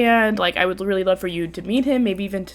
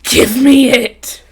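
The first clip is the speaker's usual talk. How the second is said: loud, slow, drawn-out demand; greedy commenter; 4 semitones higher, 6 dB louder, much slower than usual. Acted. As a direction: play an over-eager fan, stretching each word. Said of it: "some girl in the comments who's gonna be like".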